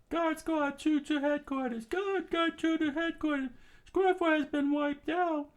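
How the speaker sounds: high urgent soldier report, far off; soldier on radio. Acted. Guard reporting to headquarters, high and urgent. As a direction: report losses in a frantic high voice.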